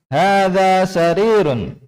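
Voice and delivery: "Hadza sarirun" high and slow, long vowels held, falling at the end; model statement intonation. Shown as a demonstration. Teacher reads for the class to follow, drawn-out statement intonation. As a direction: stretch "Hadza sarirun" on a high pitch, then drop. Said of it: "Perhatikan panjang pendeknya dan intonasinya".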